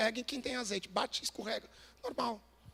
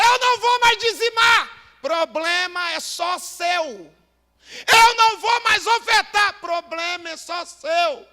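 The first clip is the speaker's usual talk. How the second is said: shouted, much higher sermon at full force; roaring pulpit cry; fiery preaching; 9 semitones higher, 19 dB louder than usual. Acted. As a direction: preach it hard to the pastors.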